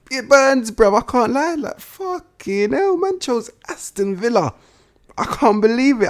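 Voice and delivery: high, loud outraged wail of disbelief; exasperated disbelief. Real, not acted. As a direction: cry out like the news physically hurts. Disbelief at Buendia choosing Villa.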